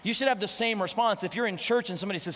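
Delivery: ordinary delivery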